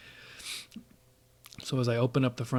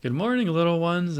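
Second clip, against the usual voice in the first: high, louder sing-out greeting; imitated friendly yell; 7 semitones higher, 5 dB louder than usual. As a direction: call it across the street like a neighbor. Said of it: "yells over at me and says".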